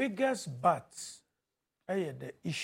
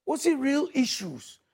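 ordinary talk, nothing marked

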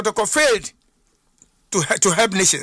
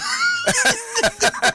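a short laugh that squeaks up high. Real, not acted. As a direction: laugh at your own punchline. Guest laughs at own anecdote.

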